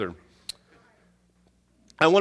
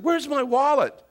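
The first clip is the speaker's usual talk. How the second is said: high-pitched mock exasperation; retold plea from a flustered dad; exasperated question; 11 semitones higher, 4 dB louder than usual. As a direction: ask it higher, at your wits' end. Frustrated father asks small daughter.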